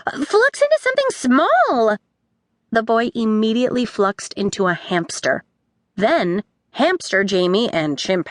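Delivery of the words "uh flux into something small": put-on high, urgent girl voice; girl crying out. A narrator's reading. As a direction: yell the warning across the room. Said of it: "a girl cried".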